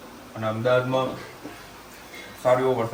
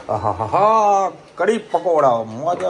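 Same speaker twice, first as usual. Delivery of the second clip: drawn-out, higher, louder delight; 6 semitones higher, 9 dB louder, slower than usual. Real, not acted. Father relishing fresh kadhi pakoda, slow and thrilled. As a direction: savor it out loud, thrilled.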